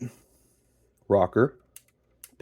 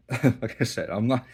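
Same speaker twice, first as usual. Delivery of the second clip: ordinary delivery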